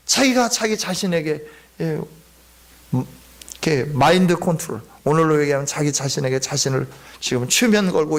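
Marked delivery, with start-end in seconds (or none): none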